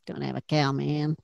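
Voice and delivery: low, croaky cartoon kid voice; Bart Simpson impression. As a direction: do Bart's catchphrase in a gravelly voice. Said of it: "Bart Simpson".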